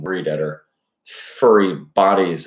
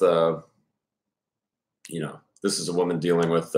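ordinary delivery

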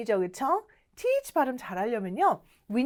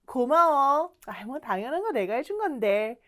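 usual speaking voice

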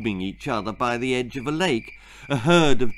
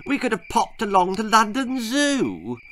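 put-on grumpy boy; 10 semitones higher than usual. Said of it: "added a very grumpy Percy".

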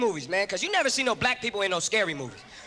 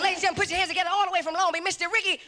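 fast, high hype MC intro; high-speed hype-man introduction; announcer voice; 6 semitones higher, faster than usual. Retold or imitated. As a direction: boom it out like a club emcee.

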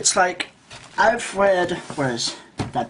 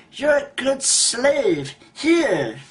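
higher, drawn-out patronizing praise; mocking society voice; 9 semitones higher, much slower than usual. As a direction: praise them slowly like an obedient slave. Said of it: "The society says".

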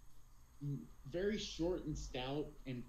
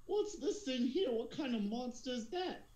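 high-pitched, yelping deckhand voice; pirate crewman; 11 semitones higher than usual. Acted. Crewman asking the boss about a monster, high-pitched underling voice. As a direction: pipe up much higher, as a startled underling. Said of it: "one of the guys is like".

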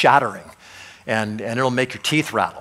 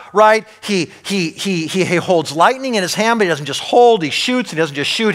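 higher, louder passionate preaching; preaching at full force; 6 semitones higher, 7 dB louder than usual. Acted. Preacher urging the congregation, full force. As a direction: build the fire of the sermon.

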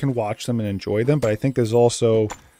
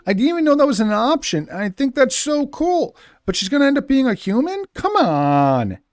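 surprised; 13 semitones higher, 5 dB louder than usual. Real, not acted. Shocked by a game surprise.

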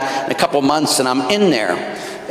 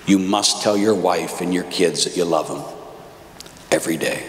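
usual speaking voice

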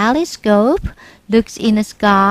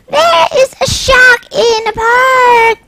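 high, loud fearful wail; boy crying that a shark is in the park; frightened cry; 11 semitones higher, 6 dB louder than usual. Acted. Boy cries shark again.

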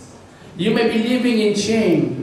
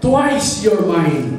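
very slow, louder, ringing declaration; boy's triumphant claim; 3 semitones higher, 4 dB louder, much slower than usual. Acted. Boy to the toy he made and bought, triumphantly. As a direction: stretch it out loudly in triumph. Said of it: "sabi ng bata".

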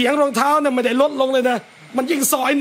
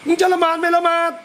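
put-on higher, scolding shout at the child; rude harsh call; 5 semitones higher than usual. Shown as a demonstration. Parent yelling rudely at child to pray, harsh. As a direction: bark at her to pray. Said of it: "แต่ถ้าพี่น้องยังกลับไป".

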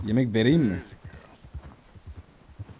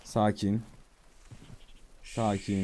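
soft, low calming voice; soothing; 6 semitones lower, 10 dB quieter, much slower than usual. Pretend. Player calming a spooked horse, soft and soothing. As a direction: gently settle a nervous animal.

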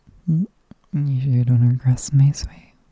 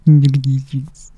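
put-on gushing baby talk; 2 semitones higher, 6 dB louder than usual. Cooing over cheeks, repeated louder.

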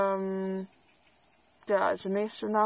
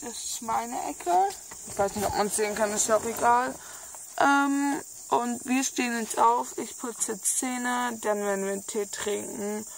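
speech slurred by a brace. Real, not acted. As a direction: talk around braces. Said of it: "Ich hab noch meine Zahnspange drin".

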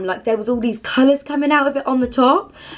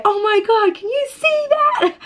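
much higher, slowed, wide-eyed wonder; amazed stranger; 12 semitones higher than usual. Retold or imitated. Another guy to his friend at the fire, high amazed voice. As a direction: imitate a stranger gasping at the flames. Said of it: "hearing this other guy, like, say".

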